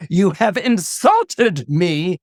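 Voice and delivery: slow, loud, weighty rebuke; offended goddess. Acted. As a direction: pronounce it slowly and forcefully, deeply affronted.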